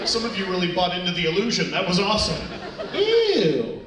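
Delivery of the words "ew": loud, high drawn-out ew; hammed-up grossed-out cry, sliding down; mock disgust. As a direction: squeal in exaggerated disgust. Mocks disgust at imaginary mess.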